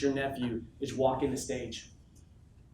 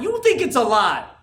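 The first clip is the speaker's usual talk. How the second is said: louder and slower, "think" hit with a falling close; forceful retort; 9 semitones higher, 11 dB louder, slower than usual. Real, not acted. Speaker to his team, loudly throwing back their doubt. Answers own question louder.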